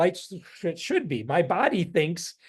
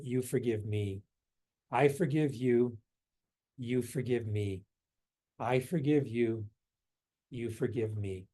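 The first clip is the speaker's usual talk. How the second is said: soft meditative mantra; 5 semitones lower, 6 dB quieter than usual. Shown as a demonstration. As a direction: let it sink to a murmur step by step. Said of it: "The right foot".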